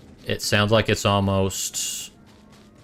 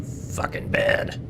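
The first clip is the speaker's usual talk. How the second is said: muttered; 11 semitones lower, 4 dB quieter, slower than usual. Real, not acted. Muttered curse.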